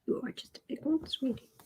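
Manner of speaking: soft whisper to a pet; whispered cooing. Real, not acted. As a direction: whisper sweet nothings to the cat. Cooing at her cat.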